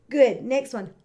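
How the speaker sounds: ordinary talk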